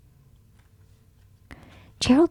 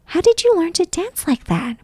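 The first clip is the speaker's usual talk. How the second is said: higher eager asking; eager question; 8 semitones higher than usual. A narrator's reading. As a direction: beg him to tell, excited. Animals beg Gerald.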